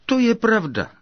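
high-pitched burst of delight; gladly exclaimed, pitch leaping up. A narrator's reading. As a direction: rejoice as the idea clicks. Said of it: "zaradoval se pan Čapek".

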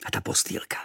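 soft whispered delight. Acted. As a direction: breathe it out in wonder. Boy admires bed.

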